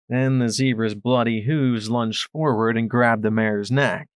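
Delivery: usual speaking voice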